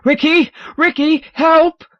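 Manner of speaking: high piercing shrieks for help; shrieking. Acted. Ron shrieking for Ricky, high loud panicked screams. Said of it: "a face that he remembers shrieks".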